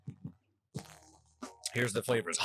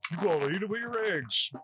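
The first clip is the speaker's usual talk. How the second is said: lightly mocking voice of the food blogs, pitched up; put-on blog voice; 6 semitones higher than usual. Host mocking blog advice in a silly voice. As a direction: quote the blogs in a mock voice. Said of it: "that's not how they talk".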